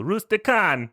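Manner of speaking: high mock-Italian, last syllable dropped; fake Italian accent. Shown as a demonstration. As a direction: punch it out like a cartoon Italian. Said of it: "to do a proper fake Italian accent".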